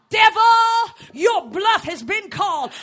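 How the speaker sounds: loud, high defiant preaching; preaching at full force. Acted. Preacher defiantly shouting down the devil.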